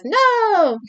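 high-pitched, drawn-out mock "no"; dramatic cry. Character leaps to block a stabbing.

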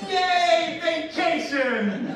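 high, loud, stretched-out hooray; hammed-up sarcastic celebration, dragging the last word; mock cheer. Parent facing miserable kids on vacation, mock cheering.

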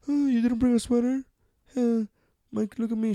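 high-pitched mock girlfriend voice; imitation de copine. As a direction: mimic a girlfriend in a high, put-on voice. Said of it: "But she".

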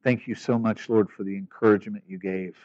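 soft, earnest closing prayer. Acted. Pastor praying over his congregation at the end, soft and earnest. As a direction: pray quietly over the church.

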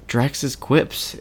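ordinary talk, nothing marked

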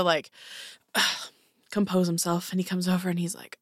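normal talking voice

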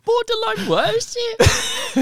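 slow, high, laughing repeat; laughing disbelief. Real, not acted. Friend echoing a boast, slow and laughing.

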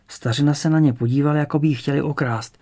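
ordinary delivery

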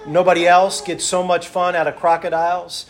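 loud, rhythmic pulpit delivery, listing each item; fervent preacher's list; preaching. Acted. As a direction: preach it to the congregation.

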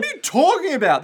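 loud, high-pitched protest; indignant disbelief. Real, not acted. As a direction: object as if it's absurd, voice leaping up. Disputes the fashion criticism.

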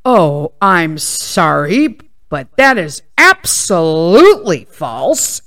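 slow, drawn-out prissy correction; mock-offended slow clerk voice; snooty clerk. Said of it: "he's like".

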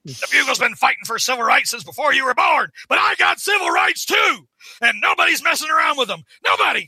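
high, shouted, indignant rant; J. Jonah Jameson. Acted. Jameson defends his civil rights to protesters, building shouted bluster. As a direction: build into a hammed-up boss tirade. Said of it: "That's a good Jameson".

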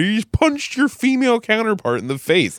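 high, sharp angry order; angry ejection. Retold or imitated. Bouncer to DJ who hit girlfriend, high angry order. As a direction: throw him out in outrage.

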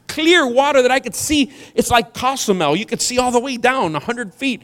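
high, loud indignant rant; enraged commander. Acted. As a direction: play an upset general venting in disbelief. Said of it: "He's enraged. He's upset".

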